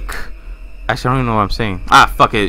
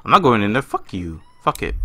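usual speaking voice

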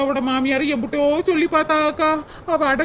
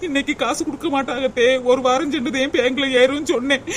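ordinary talk, nothing marked

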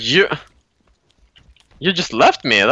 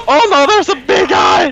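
loud, high cry of alarm; alarmed shout; 6 dB louder than usual. Real, not acted. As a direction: shout a panicked warning. Spots a big enemy.

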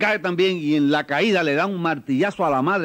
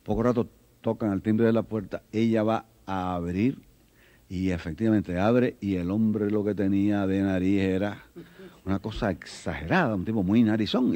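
ordinary talk

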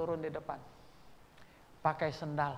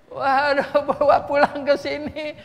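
excited exclamation; 11 semitones higher, 14 dB louder, slower than usual. Real, not acted. Excited climax of story.

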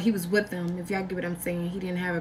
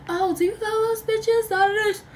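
mock jealous girlfriend; 11 semitones higher than usual. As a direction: imitate her confronting him, pitched way up. Girlfriend's challenge retold.

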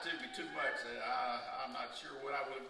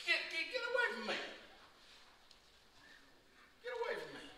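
much higher and sharp, waving someone off; brusque dismissal; much faster than usual. Retold or imitated. Jesus brushing off the pleading mother, high brusque dismissal. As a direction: shoo her away impatiently.

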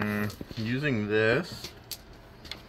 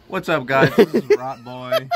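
loud high YouTuber greeting, laughing; vlogger intro voice; 6 semitones higher, 9 dB louder than usual. Retold or imitated. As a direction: hype up the camera intro. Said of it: "I'm like".

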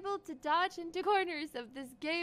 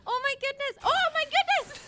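high, raised exclamation, growing louder; astonished cry; 6 semitones higher, 6 dB louder than usual. Real, not acted. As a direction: exclaim it in mounting shock. Delighted shock in the game.

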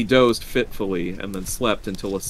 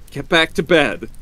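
raised, unsettled voice; unsteady; 7 semitones higher, 6 dB louder than usual. A narrator's reading. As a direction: order a wife back, rattled. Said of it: "he said unsteadily".

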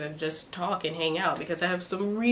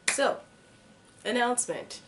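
ordinary delivery

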